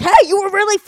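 loud high gushing bro voice; hyped-up friend. Retold or imitated. Friend at the bar greets her, loud and gushing. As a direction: burst in like an excited party guy.

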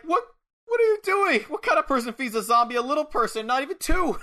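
high-pitched appalled questioning; mock outrage. Suge scolds Gene over little Jimmy, mock-appalled protest. Acted appalled reaction to partner.

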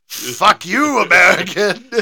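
loud, slow, bad Scottish accent; hammed-up Scottish local telling off an American; mock angry Scot in a poor Scottish accent. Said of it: "neither of us can do a Scottish accent".

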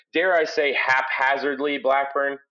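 ordinary delivery

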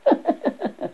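acted high giggle; short burst of high giggling. A narrator's reading.

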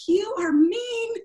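high, slow, crying protest; mock-sobbing you are mean; tearful whine. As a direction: wail it like a hurt child. Angry outburst quoted to husband.

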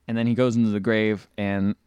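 ordinary delivery

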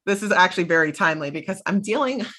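normal talking voice

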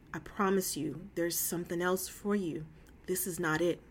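God's soft, low, comforting no; tender refusal. Acted. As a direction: let them down softly like a loving parent. God gently refusing a child.

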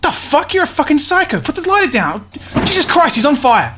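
high, alarmed outburst; panicked yelling. Acted. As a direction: yell in horror as it gets out of hand. Guest setting himself on fire.

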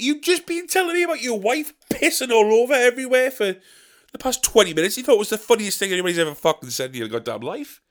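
high-pitched, incredulous rant; hammed-up exasperated outburst; indignant retort. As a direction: protest the hypocrisy in a strained high voice. Acted indignant retort to the guy.

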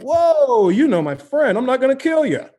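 high, loud, backing-off imitation; mock friendly cop. Cop realizing he knows the friend, mock friendliness. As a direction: back off loudly, suddenly chummy.

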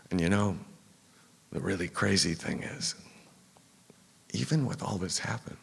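ordinary talk, nothing marked

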